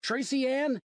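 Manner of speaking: high, loud frantic call; shouting for daughter. A narrator's reading. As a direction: call out across the park. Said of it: "I shouted".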